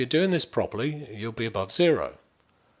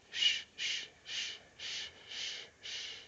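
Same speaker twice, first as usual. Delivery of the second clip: soft rhythmic unvoiced shush; shushing sound; 15 dB quieter than usual. Shown as a demonstration. Coach leading listeners in a calming sound, soft shushing. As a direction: hush them with a steady shh. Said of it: "I'm gonna make a sound".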